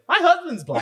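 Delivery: high mock-lady blurt, on a laugh. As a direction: blurt it high, mimicking her.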